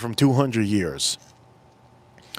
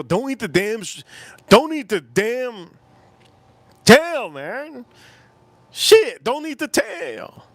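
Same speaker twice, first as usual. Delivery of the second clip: louder and higher pleading; rising rant; 9 semitones higher, 3 dB louder, slower than usual. Real, not acted. Warning chat about shrimp tails, ranting louder. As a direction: build to a yelling warning.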